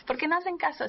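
lightly voiced mom defending her yelling; mock exasperated mom. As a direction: play a fed-up mother justifying herself. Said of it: "algunas mamás van a decir aquí".

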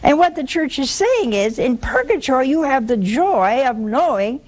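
usual speaking voice

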